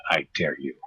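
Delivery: normal talking voice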